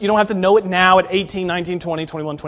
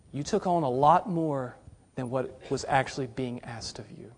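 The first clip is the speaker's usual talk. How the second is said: gentle rebuke; 6 semitones lower, 13 dB quieter than usual. Retold or imitated. Jesus answers the overloaded climber.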